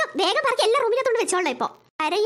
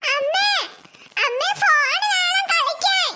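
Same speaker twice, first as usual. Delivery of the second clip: put-on slow, higher whining toddler voice; whiny small child; 4 semitones higher, much slower than usual. Little girl begging her mother for the phone, whining. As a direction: drag the words out like a begging little kid.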